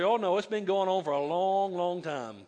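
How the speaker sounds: ordinary talk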